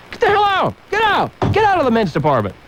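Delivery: high, strained mock yell; hammed-up high-pitched shout. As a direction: scream them out of the men's department. Ranting, yelling at women shoppers.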